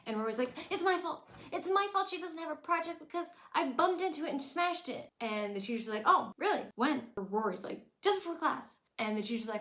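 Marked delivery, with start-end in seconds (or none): 0.7-5.1 high, loud, eager voice as Rory; guilty confession. Retold or imitated. 6.1-7.0 high, loud mock disbelief; sharp suspicious question; skeptical teacher. Teacher doubts Rory's story. 8.0-8.8 high, loud quick reply; eager answer. Retold or imitated. Rory answers teacher eagerly.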